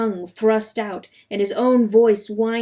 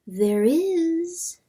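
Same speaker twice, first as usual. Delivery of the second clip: higher, drawn-out eerie voice; dream voice; 9 semitones higher than usual. A narrator's reading.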